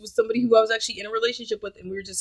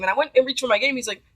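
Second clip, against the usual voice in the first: ordinary delivery, nothing marked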